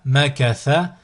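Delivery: syllables joined as one word. Shown as a demonstration. Teacher models a word for learners to repeat, joined in one go. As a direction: run the syllables together without pauses. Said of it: "le mot attaché".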